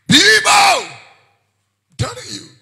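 shout. Real, not acted. Preacher cries out warning.

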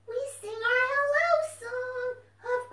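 high, slow, sing-song puppet voice; puppet fox voice. Acted. Puppet fox tells the children about the song, high and sing-song. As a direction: chirp it out like a happy puppet.

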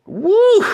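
high excited whoop. Real, not acted. Cheering the defense for listeners, high woo. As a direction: let out a big happy woo.

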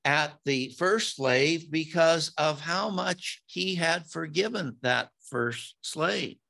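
normal talking voice

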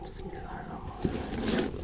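hushed whisper under the breath; whispered prayer. Real, not acted. Praying while handling the fish.

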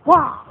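a burst of a bored cry; sudden loud wail. Real, not acted. Bored vlogger letting out a loud wail. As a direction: blurt out a loud wah.

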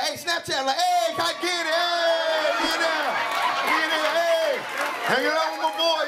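high, stretched-out hollering for the camera; hyped-up mock selfie-video voice; excited showing off. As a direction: show off to your Snapchat followers. Acting out excited posing for Snapchat.

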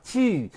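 high, falling "Tieu"; tongue-forward pronunciation. Shown as a demonstration. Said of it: "creates a V in your tongue".

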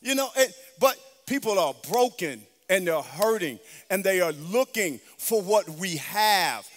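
fiery gospel sermon; full-force preaching. Acted. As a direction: preach it to the rafters, stretching the key words.

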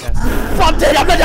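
shout. Real, not acted. Sudden scare shout.

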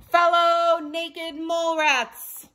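slow, high royal address; regal proclamation. A narrator's reading. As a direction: proclaim to the colony.

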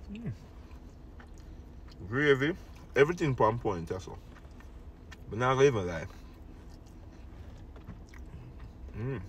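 chewing between words; eating while speaking; mouth full. Real, not acted. Seen 3: Takes forkful at 1.5s, chews between phrases while talking.

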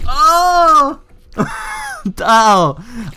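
sudden high, loud moan; weird moan. Real, not acted. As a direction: cry out in a high, startled moan. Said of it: "weirdest sound I've ever made".

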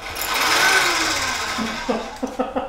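a high, rising laugh. Real, not acted. Laughs at an unsafe move.

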